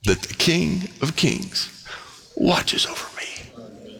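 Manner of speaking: slow, whispered reverent close; hushed whisper. Real, not acted. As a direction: breathe it softly, slowly. Pastor's hushed close of thought.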